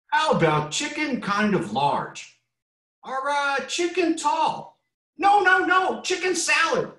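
very high, slow, then shouting chicken; squeaky high chicken voices. Acted. Reading aloud, chickens argue the book title in squeaky voices.

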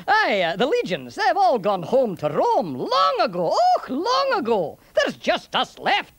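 put-on Scots old soldier, pitch swooping up; lilting Scottish lament for the departed; Scottish centurion, wistful. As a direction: play a left-behind centurion with a Scots burr.

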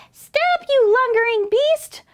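high, loud angry squeal; high-pitched outraged command; bellowing mouse. A narrator's reading. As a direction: bellow it as a tiny furious mouse. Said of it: "bellowed Mouse".

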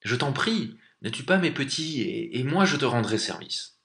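anxious mother's entreaty; pleading. Acted. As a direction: beg for your little ones' lives. Mother bear begs for cubs.

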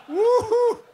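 very high, sustained woo-hoo; high whoop. Real, not acted. Preacher winds down from his own joke, high whoop. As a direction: let out a whoop after laughing.

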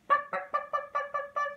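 very high clucking; chicken clucking. Shown as a demonstration. Teacher clucks like a chicken for kids. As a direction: cluck like a hen. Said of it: "What does a chicken say?".

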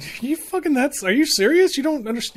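high-pitched, exasperated disbelief; incredulous. Retold or imitated. Arguing with a friend over a bullfrog, incredulous. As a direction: retell it as an incredulous challenge.

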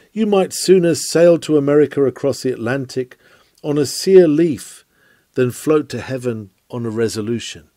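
ordinary talk, nothing marked

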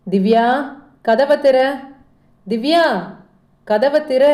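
mother shouting her daughter's name and order; angry calling out. A narrator's reading. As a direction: call sharply for the door to be opened. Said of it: "என்று கோபத்தில் கத்த".

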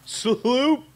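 high loud questioning sloop; amused disbelief. Real, not acted. Friend repeats the funny word sloop, loud and high. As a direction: echo the odd word incredulously. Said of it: "parents' sloop".

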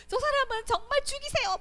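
high, loud outcry for a death sentence; indignant demand. Retold or imitated. Someone demanding death for a murderer, loud high outcry. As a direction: cry out for the killer's death.

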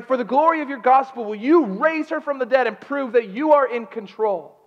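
high, loud, urgent prayer; pleading prayer. Retold or imitated. Husband praying for his wife's life, urgent pleading. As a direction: plead with God at full force. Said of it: "so I started praying".

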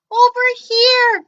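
high loud call. Acted. Bork calling Gork over, high and loud. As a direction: shout across space. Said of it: "called Bork".

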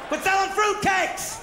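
high, drawn-out mock shout; irate customer. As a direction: holler it like a fed-up customer, stretching the words. Acted irate customer at return counter.

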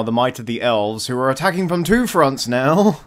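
normal talking voice

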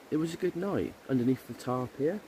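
ordinary talk, nothing marked

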